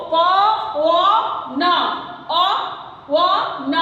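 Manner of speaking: प, व, न, अ, व, न each sound said alone with pauses; syllable by syllable. Shown as a demonstration. Teacher sounding out पवन to students, each letter separated. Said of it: "पो बोल रहे थे".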